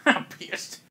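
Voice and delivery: breathy echo of a seething villainess; mock-furious hush; whispered anger. Game master echoes whispered line.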